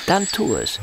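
a short sour laugh into the words; bitter laugh. Acted. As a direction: laugh it off, cutting. Bitter permission from wheelchair.

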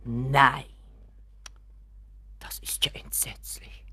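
aghast, barely voiced; shocked whisper. Mother hears of the embezzlement, shocked whisper. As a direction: breathe it out in horror.

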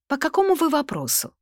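bright, businesslike receptionist; brisk woman's voice. Acted. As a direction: lift it like a cheerful secretary. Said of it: "бодрый женский голос".

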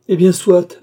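high, loud burst of acceptance; forceful concession. A narrator's reading. Brother yielding to his silent sister, forceful concession. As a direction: give in with a pained outburst.

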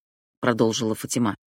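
plain reading voice

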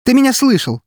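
very high, loud angry cry; high-pitched furious outburst; cried out. A narrator's reading. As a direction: shout it in shrill defiance. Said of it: "выкрикнула она".

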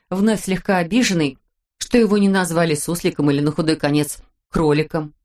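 usual speaking voice

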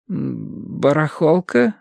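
slow, hesitant, rising query; unsure echo question. A narrator's reading. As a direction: draw the word out doubtfully. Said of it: "неуверенно переспросил священник". (неуверенно переспрашивая, медленно)

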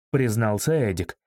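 usual speaking voice

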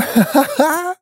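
high, mocking villainous laugh. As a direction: burst into a gloating laugh. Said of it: "Вдруг рассмеялся Морн".

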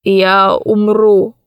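slow, weary groan; groaning old man. Acted. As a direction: moan it out, spent. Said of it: "простонал старик".

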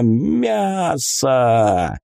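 hungry beast shouting with joy; a monster book howls for meat; gleeful monster yell. A narrator's reading. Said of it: "обрадованно завопила".

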